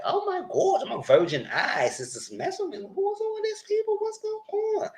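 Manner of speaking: falsetto wail of disbelief; high mock horror; high shocked cry. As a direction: shriek it like a scandalised prude. Hall's shocked reaction acted.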